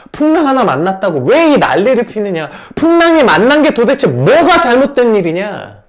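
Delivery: loud indignant rebuke. Acted. Jesus rebuking the disciples, loud indignant question. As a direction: hurl the question back at them. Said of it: "이렇게 말하고 있는 것이죠".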